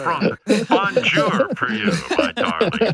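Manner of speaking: mock French lover. Host mocks the French suitor wooing Tan Mom, high hammed-up French accent. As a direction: play a cheesy French playboy in a silly high voice. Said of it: "Does he speak with a French accent?".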